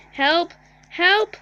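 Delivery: loud, rising cries for help; shouting for help. Real, not acted. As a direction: yell help, rising in panic. Calling for help in disaster.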